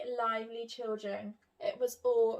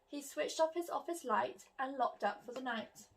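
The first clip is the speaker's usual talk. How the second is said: ordinary delivery, nothing marked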